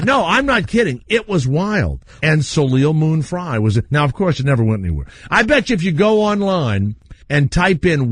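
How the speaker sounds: ordinary delivery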